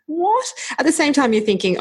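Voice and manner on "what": a very high, rising "What?"; mother retelling her shock at daughter's news. Retold or imitated. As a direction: gasp it in disbelief. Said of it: "I was like".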